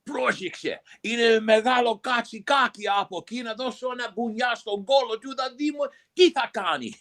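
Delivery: high, excitable Greek-speaking wildlife-host parody; Steve Irwin. Acted. Comedian doing his Greek bit, high parody impression. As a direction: shout it in Greek like an over-eager animal presenter. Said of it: "doing the Greek version of Steve Irwin".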